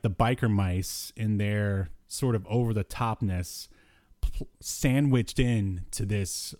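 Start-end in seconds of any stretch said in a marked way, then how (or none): none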